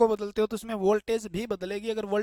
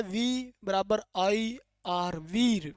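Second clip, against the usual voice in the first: slow spelling-out; much slower than usual. Shown as a demonstration. Teacher turning the formula into a mnemonic, spelled out slowly. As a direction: stretch वी and IR letter by letter.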